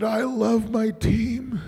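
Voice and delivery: high, drawn-out imitation of weeping; mock tearful fan. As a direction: imitate a tipsy fan getting weepy, slowly. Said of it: "then he just starts crying".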